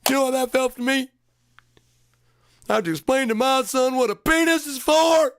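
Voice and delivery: high, loud exasperated complaint; dad furious he had to explain; indignant rant. Real, not acted. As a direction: vent the outrage at full pitch. Outraged rant about explaining penis.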